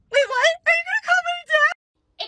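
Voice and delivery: high, shrill-edged squeal of panic; hammed-up fright at being reported; mock panic. Teen driver to officer about her dad, mock panic.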